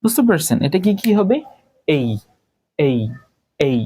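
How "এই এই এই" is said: "এই" as a falling ay glide, said separately; correct letter A. Shown as a demonstration. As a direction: model "এই" with an i glide at the end. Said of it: "এভাবে উচ্চারণ করবো আমরা". ("এই" আলাদা করে, ই-তে নামিয়ে)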